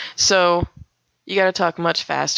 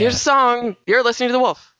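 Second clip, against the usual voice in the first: high, projected station ID; yelling radio DJ; 6 semitones higher than usual. Shown as a demonstration. DJ introducing a song on country radio, projected announcer voice. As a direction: throw to the song like a DJ. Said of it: "you're yelling into that mic".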